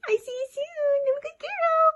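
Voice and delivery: very high pet voice. Real, not acted. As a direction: squeak it to the dog.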